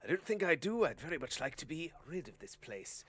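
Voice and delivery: high, loud start easing down into polite refusal; well-dressed gentleman. Acted. Gentleman refusing the woman's plea, prim polite refusal. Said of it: "And the well-dressed gentleman goes".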